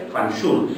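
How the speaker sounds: Fanxul said as fan-shul, sh in the middle; Asturian sh sound. Shown as a demonstration. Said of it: "pronúnciase en X".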